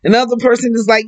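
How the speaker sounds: normal talking voice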